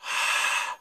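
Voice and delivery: long open exhale, no voice; hand-warming breath. Shown as a demonstration. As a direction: breathe out as if warming cold hands.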